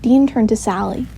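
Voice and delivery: plain reading voice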